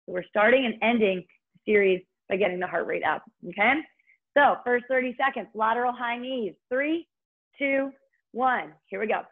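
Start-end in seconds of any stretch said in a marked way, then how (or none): 4.4-9.2 upbeat workout cueing; aerobics instructor. Acted. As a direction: pump the class up with energy. Fitness instructor cueing cardio.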